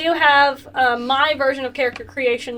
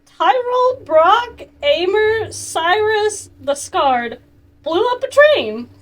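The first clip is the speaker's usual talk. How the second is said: awed listing; 7 semitones higher, 4 dB louder, much slower than usual. Retold or imitated. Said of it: "he's like".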